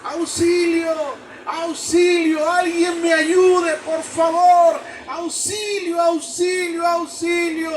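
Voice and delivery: high desperate shouting; cries for help. Acted. Climber shouting for help in the dark, desperate yell. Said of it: "comenzó a dar gritos".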